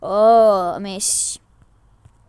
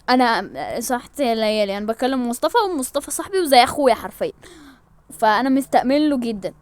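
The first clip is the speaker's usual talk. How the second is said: normal talking voice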